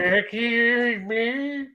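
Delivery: high, very drawn-out garbled voice; garbled mock cry. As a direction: stretch it out high, as if failing to say a word. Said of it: "He's trying to say Lucifer, but he had a stroke".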